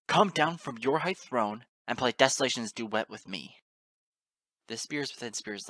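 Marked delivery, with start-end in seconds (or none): 0.1-3.6 loud, growled challenge; growled command. A narrator's reading. Narrator challenging a goddess to play a duet, growled. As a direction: bellow the demand in anger. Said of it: "I growled".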